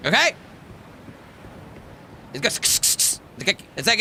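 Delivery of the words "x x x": "X, X, X" as a voiceless hissing breath; hissed letter sound. Shown as a demonstration. Showing how the letter X sounds in his language, hissed like a cat. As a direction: hiss "X, X, X" like an angry cat. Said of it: "it's like an angry cat".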